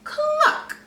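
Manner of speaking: high clucking hen; a high chick's cluck; chicken cluck. A narrator's reading. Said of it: "Cluck said and nodded".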